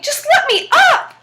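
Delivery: high, loud, slow desperate plea; begging little devil. Acted. Trapped little devil pleading with Jack to let him up, high and loud. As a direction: drag out a squealing plea.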